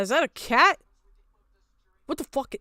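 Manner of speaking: ordinary talk, nothing marked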